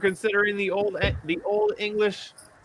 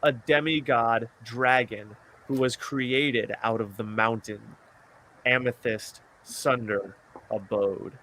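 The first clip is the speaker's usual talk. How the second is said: story narration; 7 semitones lower, slower than usual. Acted. Reads fantasy story aloud.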